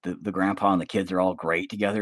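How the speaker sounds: usual speaking voice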